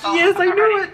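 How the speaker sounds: high, thrilled cry of triumph; excited squeal. Retold or imitated. Husband recalling his reaction to the good news, high thrilled squeal. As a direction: squeal it like you just won. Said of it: "I'm like".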